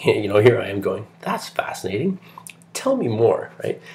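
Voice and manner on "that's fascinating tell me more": high-pitched, eager retold reaction; hammed-up keen interest; acted fascination. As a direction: play up how intrigued you were, pitch way up. Acts out fascinated reply to friend.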